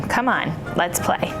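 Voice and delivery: ordinary delivery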